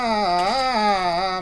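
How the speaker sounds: loud sudden yelp of fright; startled yelp. Real, not acted. Gamer spooked while sneaking past enemies, loud startled yelp. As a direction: yelp as something jumps out.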